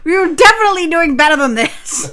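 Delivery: high, loud objection; indignant protest. Real, not acted. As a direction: burst out in amused outrage. Protests joke about nineties animal cruelty.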